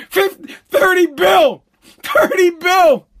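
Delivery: incredulous yell. Real, not acted. Incredulous outburst.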